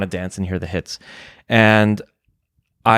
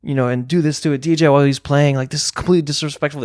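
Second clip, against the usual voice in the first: raised-pitch mock yell at a heckler; retold angry yell; 6 semitones higher than usual. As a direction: replay the fury, pitched up high. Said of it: "I started yelling at him".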